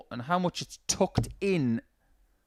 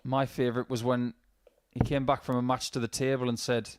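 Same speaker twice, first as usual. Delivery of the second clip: ordinary talk, nothing marked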